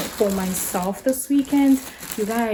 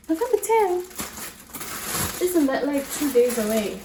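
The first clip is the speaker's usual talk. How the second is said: high-pitched, incredulous double take; much higher, doubtful repeat; surprised questioning; 5 semitones higher than usual. Real, not acted. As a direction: question the date in disbelief. Questions the date just read.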